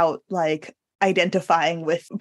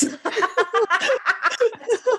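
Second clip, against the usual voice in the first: high laughing fit; 14 semitones higher than usual. Real, not acted. Laughing at butt slang.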